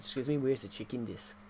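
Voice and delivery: Kiwi vowels, desk sounding like "dis"; New Zealand accent. Shown as a demonstration. Granddad overhears someone at the airport, New Zealand accent. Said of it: "in a New Zealand accent".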